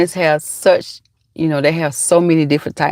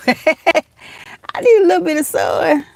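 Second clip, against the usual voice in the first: high, stretched-out mock begging; laughing into a slow, high whine; playful plea; 11 semitones higher, much slower than usual. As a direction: plead slowly in a silly high voice. Playful plea while adjusting the visor.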